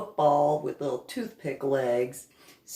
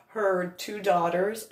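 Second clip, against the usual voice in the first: ordinary delivery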